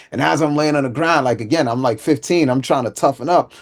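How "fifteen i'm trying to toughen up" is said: usual speaking voice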